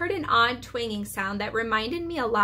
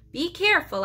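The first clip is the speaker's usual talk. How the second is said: higher, urgent warning; raised careful warning; 7 semitones higher than usual. A narrator's reading. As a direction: warn him off quickly. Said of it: "I warned him".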